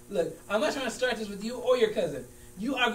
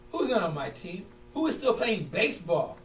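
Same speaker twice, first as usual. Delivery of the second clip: normal talking voice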